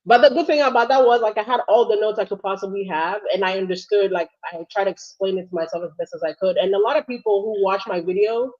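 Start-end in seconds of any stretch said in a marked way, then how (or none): none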